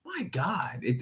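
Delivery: usual speaking voice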